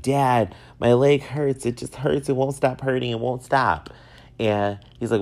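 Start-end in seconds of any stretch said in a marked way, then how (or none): 0.0-3.9 put-on higher, louder whiny plea like a hurting child; big baby. Calling his dad about a sore knee, whining like a big baby. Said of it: "I turned into a big baby".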